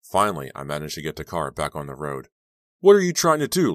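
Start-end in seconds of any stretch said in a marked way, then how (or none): 2.8-3.7 much higher, loud furious scream. A narrator's reading. Girl screaming at the boy who grabbed her while driving, furious. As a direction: scream at him in terror and rage. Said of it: "I screamed".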